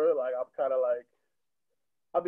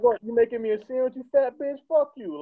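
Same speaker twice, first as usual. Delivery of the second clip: high-pitched retold outburst at a heckler; mock angry comeback; 9 semitones higher than usual. Comic insulting the heckler back, high mock anger.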